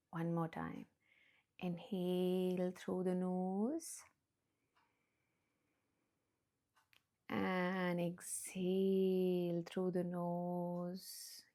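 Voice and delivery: soft slow meditation voice; hushed calm breathing guidance; guided meditation. Acted. Guides breathing, eyes closed.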